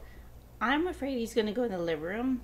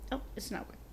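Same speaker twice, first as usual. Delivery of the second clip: normal talking voice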